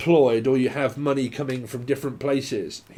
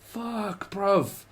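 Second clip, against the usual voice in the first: exasperated groan; 6 semitones higher than usual. Acted. Acts weary reaction to interruption.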